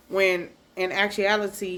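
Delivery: normal talking voice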